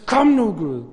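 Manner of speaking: loud, high, straining plea to God; struggling plea. Acted. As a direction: fight for it as you call out. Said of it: "slåsende som Jakob". (kæmpende, højt råb)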